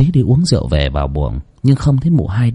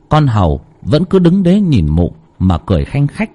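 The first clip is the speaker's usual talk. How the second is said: story narration; 4 semitones higher than usual. A narrator's reading.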